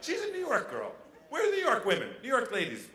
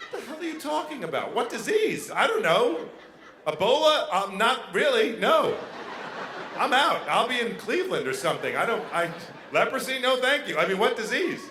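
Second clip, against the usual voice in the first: raised, mock-panicked questioning; alarmed incredulity; 4 semitones higher, 4 dB louder than usual. Boyfriend tested about loving her with a disease, incredulous. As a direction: balk loudly at the very idea. Acted reply to girlfriend's test question.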